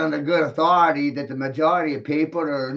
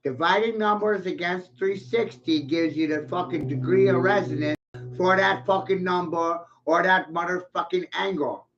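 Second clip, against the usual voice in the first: normal talking voice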